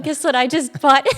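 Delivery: bubbly, high and loud; teasing announcement to husband; eager giddy reveal; excited. Retold or imitated. Excited phone call to husband.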